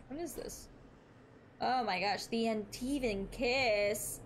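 normal talking voice

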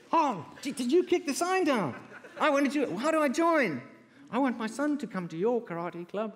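high, eager caller; gushing high-pitched caller; excited caller voice. Retold or imitated.

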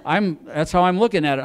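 ordinary delivery

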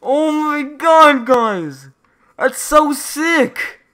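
high, loud, drawn-out excited yell; awestruck shouting. Real, not acted. Gamer showing viewers the new Void camo, awestruck shouting. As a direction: shout it slowly, thrilled by what you see.

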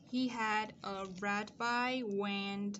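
very slow, even model reading; deliberate, evenly stretched sentence; slow dictation. Shown as a demonstration. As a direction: draw out each word for learners writing along. Modelling the sentence being written.

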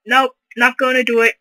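usual speaking voice

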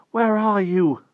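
high, loud forlorn cry; unhappy plea. A narrator's reading. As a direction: wail it unhappily, held high. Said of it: "he thought unhappily".

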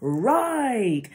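one long drawn-out word, arching high then falling; military preparatory command. Shown as a demonstration. Speaker showing a turn command, military drill call. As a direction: call out a direction like a drill instructor. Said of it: "the inflections of the voice let you know".

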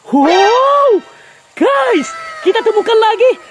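high, loud burst of excitement; thrilled shout. Real, not acted. Vlogger showing viewers a big catfish: high, loud, thrilled. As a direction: yell it out like you've struck gold.

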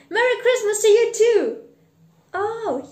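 high, loud, quick greeting; delighted boy. Acted. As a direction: shout wishes back excitedly. Boy returns the greeting joyfully.

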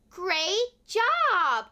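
plain reading voice

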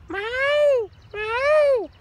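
very high, loud meow; bird call imitation. Shown as a demonstration. Prompting Joseph to try the call, high loud meow. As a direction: model the mewing call for a pupil. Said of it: "Do you wanna try?".

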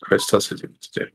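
whispered aside. Real, not acted.

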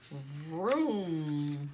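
ordinary delivery, nothing marked

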